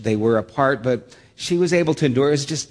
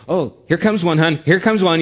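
louder, higher eager announcing; eager husband; 6 semitones higher, 7 dB louder than usual. Retold or imitated. Husband announcing contractions to his laboring wife, eager and loud. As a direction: call out each contraction eagerly. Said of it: "looking at the machine and go".

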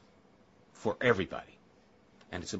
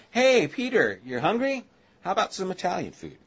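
lightly voiced, higher-pitched offer of food; God casually offering food; 6 semitones higher than usual. Voicing God offering food to Peter.

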